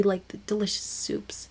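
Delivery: unvoiced, hushed awe; whisper. Real, not acted. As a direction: whisper it like a secret treat. Reverently describing the soup.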